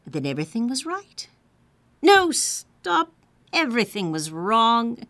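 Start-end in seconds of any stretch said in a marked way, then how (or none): 2.0-3.1 higher, louder interruption; sudden alarm. A narrator's reading. Narrator halting the story as danger looms, mildly alarmed. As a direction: cut in with a mild jolt.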